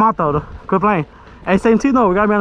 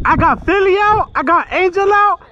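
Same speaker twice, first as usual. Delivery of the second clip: excited; 8 semitones higher, slower than usual. Real, not acted. Celebrating two hits mid-game.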